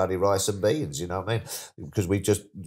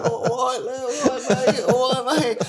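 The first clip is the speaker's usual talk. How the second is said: much higher, laughing as he greets back; laughing greetings; 4 dB louder than usual. Retold or imitated. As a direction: wave back at everyone, giggling. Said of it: "I'm going".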